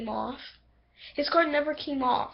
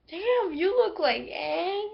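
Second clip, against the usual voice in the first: slow, higher, wondering exclamation; amazed remark; 6 semitones higher, slower than usual. Retold or imitated. Seeing Tenzin look like his father, slow amazed voice. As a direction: stretch it out in awe. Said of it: "I'm like".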